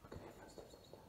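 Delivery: frightened whisper. Real, not acted. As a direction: barely breathe the words. Hiding, hears footsteps.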